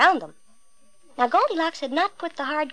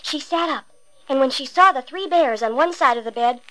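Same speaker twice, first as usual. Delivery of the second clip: usual speaking voice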